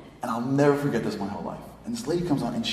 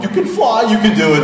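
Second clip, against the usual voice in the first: much higher and louder, eager cheering; cheering on a bird; 10 semitones higher, 10 dB louder than usual. Retold or imitated. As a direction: egg the bird on like a proud coach. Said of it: "I'm like".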